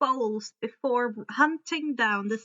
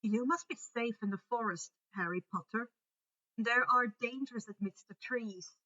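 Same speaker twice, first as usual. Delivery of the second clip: much softer, concerned; worried warning; 7 dB quieter than usual. A narrator's reading. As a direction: centaur quietly fearing for Harry. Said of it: "looked a little worried for them".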